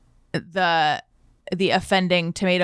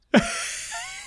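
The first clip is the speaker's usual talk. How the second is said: high laugh; 4 dB quieter than usual. Real, not acted. Laugh after telling the joke.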